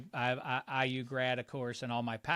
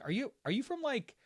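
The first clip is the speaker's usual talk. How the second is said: lightly voiced puzzled asker, pitched up; puzzled questioner; 6 semitones higher than usual. Imitating people asking where he is from, mildly puzzled. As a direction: mimic curious people, higher than your own voice. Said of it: "People have asked me over the years".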